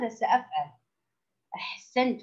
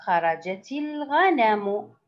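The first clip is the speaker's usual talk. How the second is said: slow, fully voweled reading; model reading; much slower than usual. Shown as a demonstration. Teacher models the picture sentence for young pupils, slow model reading. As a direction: read it out slowly, word by word. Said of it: "ألاحظ الصور وأقرأ".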